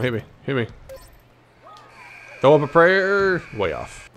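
ordinary talk, nothing marked

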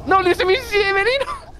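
high, panicked shout; frantic squeal, voice way up; being chased. Real, not acted. Fleeing the spider in game.

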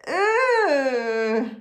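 long, loud, drawn-out ooh; exaggerated yucky reaction; disgusted ooh. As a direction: groan out a mock-disgusted ooh. Reacts to nose wiped on rabbit.

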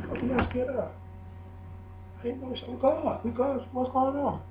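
hushed high pretend fear; mock scared voice. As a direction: play it small and spooked, half joking. Mocking a scared reaction.

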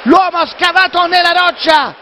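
high, fast excited play-by-play; excited commentary. Real, not acted. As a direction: keep the thrill going. Excited goal recap.

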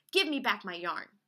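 loud storybook demand; angry demand. Acted. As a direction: demand it back forcefully through a door. Badger pounds on door demanding.

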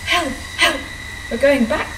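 high, loud panicked wail. Acted. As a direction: cry out for help in panic. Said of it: "wailed Henry".

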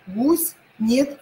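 ordinary talk, nothing marked

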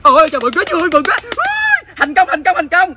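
high, loud joyful cry; excited cheer. Real, not acted. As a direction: whoop at the success. Seller celebrates a catch. (reo mừng cao giọng)

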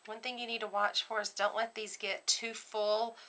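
ordinary delivery

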